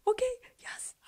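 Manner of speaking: very high breathy whispered okay yes; hushed disbelieving joy; thrilled whisper. Retold or imitated. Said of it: "I'm like".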